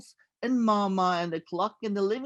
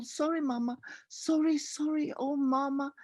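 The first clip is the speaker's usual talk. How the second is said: high, quiet, small voice apologizing; small sorry child; 5 semitones higher, 4 dB quieter than usual. A narrator's reading. Boy whispering sorry to Mama, small and high. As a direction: shrink into a tiny sorry.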